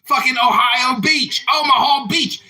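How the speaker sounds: high, loud, hammed-up ranting persona; put-on troll voice. Troll ranting at the room in a put-on voice. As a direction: shout the bit like a fake patriot troll. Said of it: "I guess I can stop talking like this now".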